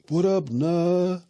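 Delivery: high, slow, drawn-out hail; exaggerated posing call-out; mock swaggering greeting. Acting out posing for a photo.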